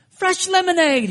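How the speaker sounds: high, loud Cockney street vendor's cry; Cockney accent. Retold or imitated. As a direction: hawk it like a cheerful Cockney kid. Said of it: "Had a Cockney accent".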